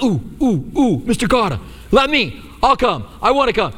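put-on like a kid begging to be picked; eager volunteer. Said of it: "And you are, are like".

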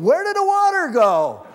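put-on high, loud little-baby voice, puzzled; bewildered baby. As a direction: play the newborn wondering where the water went.

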